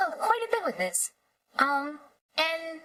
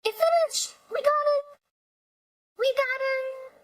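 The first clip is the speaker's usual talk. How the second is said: excited; 9 semitones higher than usual. Real, not acted. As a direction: celebrate the win. Generation finished, celebrating.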